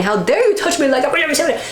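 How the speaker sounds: high, hammed-up indignant protest; mock outrage. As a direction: play the offended patient.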